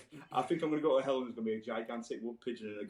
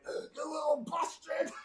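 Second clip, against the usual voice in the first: laughing mock yell, higher and slow; slower than usual. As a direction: yell it as the angry neighbours would, half laughing. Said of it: "coming out going".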